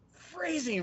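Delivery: phrasing squeaked up high and falling; a begged phrasing, pitched high; high pleading. Retold or imitated. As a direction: squeak phrasing like a desperate plea. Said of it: "and he's just like".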